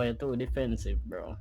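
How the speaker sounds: normal talking voice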